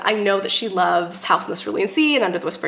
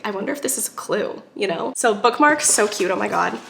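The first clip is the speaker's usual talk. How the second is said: ordinary delivery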